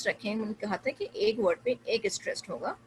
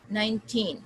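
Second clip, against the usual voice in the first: put-on nineteen with long falling TEEN; nineteen, weight on second syllable; stress on TEEN; much slower than usual. Shown as a demonstration. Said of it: "टीन पे".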